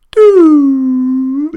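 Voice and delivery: high squeaky cry "Doo"; a sudden high wail as he slips down; imitating Phineas's "Doo". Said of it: "and then he just went".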